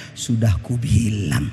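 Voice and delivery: wife's low, slow "Sudah kubilang" after the cat dies; wife's dark gloat. Retold or imitated.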